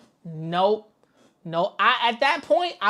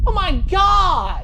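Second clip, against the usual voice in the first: loud stunned cry of disbelief; real startled shout at the screen; shocked shout; 11 semitones higher, 8 dB louder, slower than usual. Real, not acted. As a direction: blurt it out in shock. Reacting to deep snow video.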